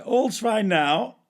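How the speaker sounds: high, loud, drawn-out all-clear; mock-cheery announcement. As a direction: sing out a hammed-up report of success. Acts out return from toilet.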